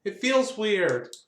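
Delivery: put-on slow, hesitant kid; a little boy unsure of his new leg; lightly voiced uneasy child; child's voice. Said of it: "He's like".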